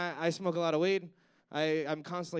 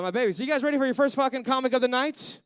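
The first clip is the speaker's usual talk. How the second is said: loud, high hype call to the crowd; 6 semitones higher, 8 dB louder, faster than usual. Real, not acted. Host firing up the audience for the first comic, loud hype. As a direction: pump the room up for the next act.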